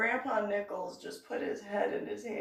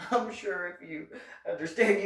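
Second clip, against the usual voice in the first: ordinary delivery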